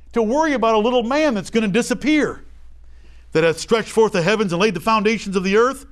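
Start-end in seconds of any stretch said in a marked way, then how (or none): none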